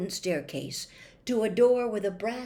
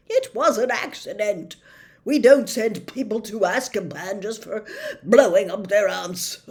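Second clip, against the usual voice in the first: chuckling reassurance; 3 semitones higher, 8 dB louder than usual. A narrator's reading.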